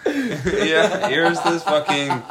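high-pitched, laughing sales pitch; laughing mock pitch. Playing the Switch store pushing deals, high and laughing. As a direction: offer it like a giggling huckster.

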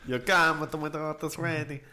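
put-on muddled Italian-Mexican godfather accent.

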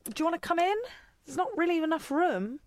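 high, sweet voice for the cat; talking to cat. Real, not acted. Helena asks her cat if it wants in, high and cooing. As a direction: coo at the cat like a pet owner.

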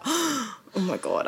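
sharp gasp, then hushed, drawn-out dismay; gasp, then let it out slowly and softly; re-enacted moment of alarm; retold freak-out. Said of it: "I kinda freaked out".

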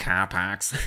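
playful Boston "cah pahks", R dropped; Boston accent. Retold or imitated. Host enjoying the sound for fun, imitated Boston accent. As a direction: mimic Boston car parks with no R, amused. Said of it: "It's fun to say".

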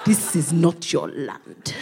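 a slow, laughing warning from the land; imagined country warning her off; Nigeria's voice. Retold or imitated. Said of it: "hear Nigeria going".

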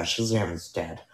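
normal talking voice

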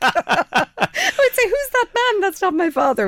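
high-pitched, laughing retelling. Real, not acted. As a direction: tell it through real laughter, voice shooting up. Laughing through a fond family anecdote.

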